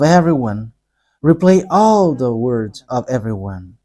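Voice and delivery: measured, reverent prayer with long pauses. Real, not acted. Leads a closing prayer.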